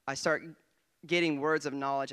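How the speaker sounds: usual speaking voice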